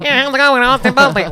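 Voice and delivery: high, loud mock greeting; jokey impression. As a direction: call it out high in a put-on voice. Jokey put-on greeting of actor.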